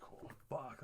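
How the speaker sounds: whispered oath, barely voiced; very soft, breathy aside; retold hushed swearing. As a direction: swear under your breath, as if replaying the moment. Retelling his scare after dumping.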